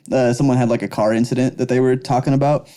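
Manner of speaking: usual speaking voice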